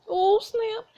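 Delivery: high-pitched hoot at a burn; delighted squeal of approval; gleeful reaction. Real, not acted. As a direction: relish it, pitch way up. Player reacts to the comeback.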